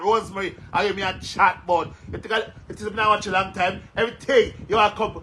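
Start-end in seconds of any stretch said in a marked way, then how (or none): none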